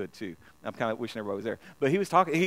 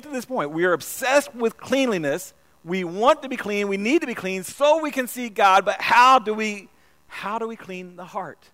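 louder, higher sermon climb; preaching build; 5 semitones higher, 7 dB louder than usual. Acted. Preacher rallying the congregation, rising and loud. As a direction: build toward the big question.

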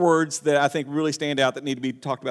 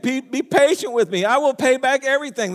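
begging plea; 7 semitones higher, 4 dB louder than usual. Acted. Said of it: "he began to beg".

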